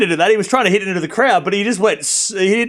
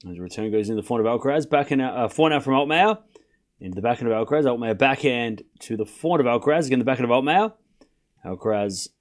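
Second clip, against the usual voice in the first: low, quiet tennis commentary; live play-by-play; 4 semitones lower, 4 dB quieter than usual. Acted. Calling the tennis rally for stream viewers, hushed commentator. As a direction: call each shot like a TV commentator.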